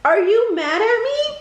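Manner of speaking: high, worried blurt; anxious exclaim. Acted. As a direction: blurt it out in a panic.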